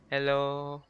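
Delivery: normal talking voice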